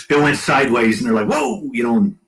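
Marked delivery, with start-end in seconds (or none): 1.3-1.7 high-pitched mock alarm; retold startled cry. Crew reacts as plane went sideways, mock startle. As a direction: yelp it as if suddenly skidding.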